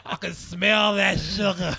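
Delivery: slow creeping menace; creepy whisper-growl. Pretend. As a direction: savor each word like a predator. Playing the creepy lady.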